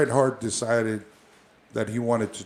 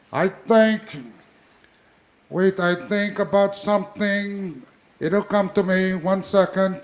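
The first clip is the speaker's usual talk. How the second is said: high, loud and slowed, a spacey wrestler drifting off; Jimmy Snuka impression; 8 semitones higher, 3 dB louder than usual. Jimmy Snuka stalls the match talk to go smoke, high and slow. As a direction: stretch it out as Jimmy Snuka, losing the thought. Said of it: "I think my conversation would be like this".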